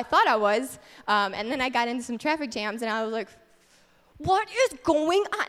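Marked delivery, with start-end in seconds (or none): 4.2-5.5 high, loud, drawn-out exasperated outburst; hammed-up frustrated cry; retold anger. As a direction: act out losing it in traffic. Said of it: "I was so angry".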